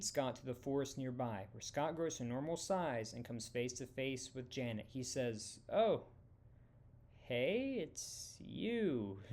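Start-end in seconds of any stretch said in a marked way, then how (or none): 5.7-9.2 higher startled greeting; surprised. A narrator's reading. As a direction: caught off guard by the person before him. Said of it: "I'm a little surprised".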